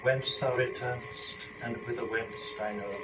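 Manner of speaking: soft and hushed, drifting down to almost nothing; softly, barely above breath. Acted. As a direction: speak as if just woken from a dream. Eve greets Adam on waking.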